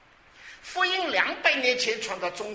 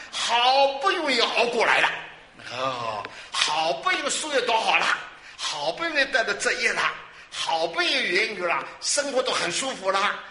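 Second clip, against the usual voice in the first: loud, raised preaching cadence; emphatic build-up; 4 semitones higher, 5 dB louder than usual. Real, not acted. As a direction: drive each item home with a high, forceful attack. Preacher builds list of hard-won successes. (高声有力地一句句递进)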